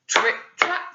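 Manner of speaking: plain reading voice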